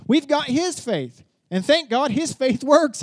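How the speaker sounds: slow, loud, high proclamation; preaching at full force. Acted. Preacher declaring to the congregation, slow and loud. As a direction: build it up and declare it.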